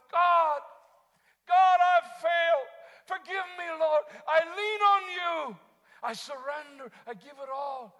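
high, very loud drawn-out cry to God; strong crying out. Acted. Preacher acts out a failed believer's surrender, crying out loudly. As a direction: shout a desperate prayer of surrender. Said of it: "supplication means strong crying".

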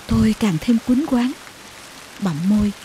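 plain reading voice

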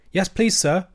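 high-pitched, deferential youngster; small creature speaking respectfully to an elder; respectful young hedgehog. A narrator's reading. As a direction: answer up high like a polite little boy. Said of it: "said the younger of the two hedgehogs respectfully".

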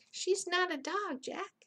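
high-pitched objection; protesting. A narrator's reading. Annie correcting Jack about the dinosaur, protesting.

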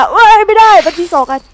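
sudden loud, high yelp of frustration; startled outburst. Real, not acted. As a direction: blurt it out as the jump fails. Fails a jump in game. (ร้องเสียงดังด้วยความตกใจ)

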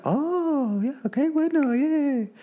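very high, thin excited squeak; mock cheery squeal. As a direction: squeal it like a giddy surprise. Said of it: "I'd be like".

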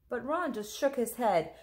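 ordinary delivery, nothing marked

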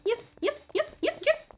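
high panicked no's. Real, not acted. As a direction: squeal in alarm. Character about to fall.

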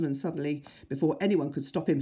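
usual speaking voice

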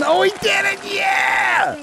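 very high whooping shout; high-pitched shout of amazed delight; excited cheer. Real, not acted. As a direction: cheer wildly for the feat. Cheers on soldier bursting a chain.

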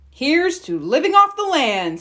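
proud Papa Bear toast. A narrator's reading. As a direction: raise a spoon proudly.

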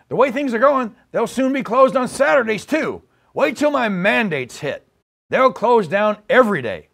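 ordinary delivery

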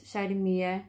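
ordinary delivery, nothing marked

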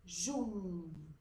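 "Jum" held long; strong syllable. Shown as a demonstration. Teacher answers which syllable is strong, "Jum" stressed and long. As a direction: stretch "Jum" firmly as one syllable. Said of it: "Sílaba forte".

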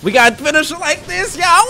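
high, loud hype call; excited hype. Real, not acted. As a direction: hype the crowd up. Hyping the finishing move.

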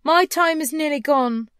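high, loud ghostly warning; urgent cry. A narrator's reading. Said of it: "cried the ghost".

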